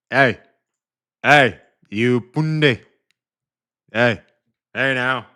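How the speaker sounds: loud sharp hey to get attention; loud playful callout; calling out. Real, not acted. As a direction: shout it out to the room. Calling out to the chat.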